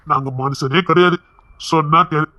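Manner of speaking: low, controlled threat; menacing warning. Acted. Interrogator claiming to be no human, menacing warning. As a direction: drop the pitch and warn coldly.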